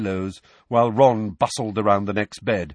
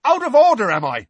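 very high, loud indignant shout; outraged yell, easing at the end; 14 semitones higher, 9 dB louder than usual. A narrator's reading. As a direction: shout it back in disbelief. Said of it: "shouted Seamus".

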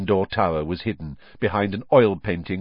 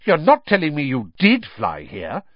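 a sharp, louder reproach; severe scolding; 6 semitones higher, 5 dB louder than usual. Acted. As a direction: sound as severe as a strict teacher. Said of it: "sounding almost as severe as Professor McGonagall".

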